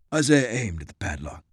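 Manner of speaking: plain reading voice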